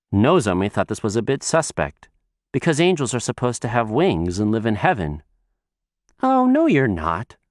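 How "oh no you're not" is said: put-on little girl voice. Child doubts the angel.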